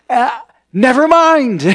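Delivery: loud, high, exasperated dismissal; worked-up brush-off. Retold or imitated. Excited student to his baffled roommate, loudly dismissive.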